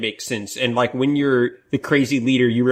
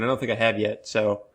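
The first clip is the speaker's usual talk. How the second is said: ordinary delivery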